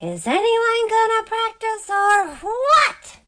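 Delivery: put-on high, impatient kid voice; change the subject, impatient. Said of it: "But I say instead".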